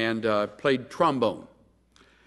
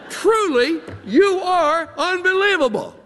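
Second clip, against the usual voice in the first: high, loud mocking disbelief; scornful, pitched-up sarcasm; sarcastic; 5 dB louder than usual. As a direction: sneer at the friends with a laugh. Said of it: "having learned a little sarcasm".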